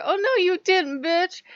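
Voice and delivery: high, loud sassy "oh no you didn't"; sassy comeback. Retold or imitated. Telling off gods' followers with sass. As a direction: snap back with full attitude. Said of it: "and I'm like".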